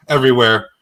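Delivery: ordinary talk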